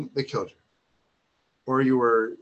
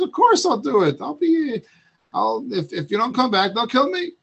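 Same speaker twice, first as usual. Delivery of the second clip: eager friend; 7 semitones higher than usual. Acted. Loyal friend eagerly agrees.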